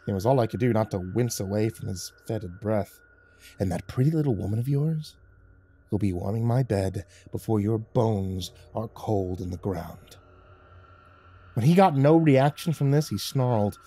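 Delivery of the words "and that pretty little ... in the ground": menacing gang leader. Acted. Leader threatens Michael.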